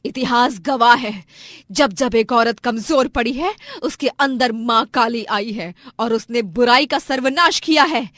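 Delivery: loud, declamatory fury; wrathful declaration. Acted. Wife invokes Maa Kali against her abuser, wrathful and loud. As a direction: proclaim like an avenger. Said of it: "क्रोधित आंखों से देखती है".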